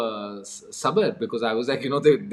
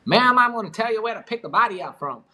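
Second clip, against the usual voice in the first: put-on American-Korean cowboy accent; 10 semitones higher, 3 dB louder than usual. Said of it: "American, Korean cowboy accent".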